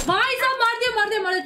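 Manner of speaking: high, loud kill celebration; excited celebrating. Real, not acted. Gamer celebrating her own kill, excited. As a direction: burst out in triumph.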